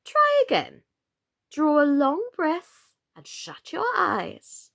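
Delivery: very high, slow coaxing; coaxing queen. Acted. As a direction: coax her gently upward.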